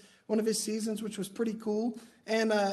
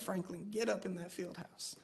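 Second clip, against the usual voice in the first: imitating a humbled coach, much softer; swallowed pride; 2 semitones lower, 11 dB quieter than usual. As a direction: give the order quietly, backing down. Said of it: "the coach swallowed his pride".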